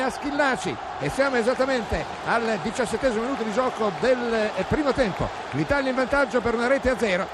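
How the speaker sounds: high, loud breathless recap after the goal; excited recap. Real, not acted. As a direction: still riding the goal high.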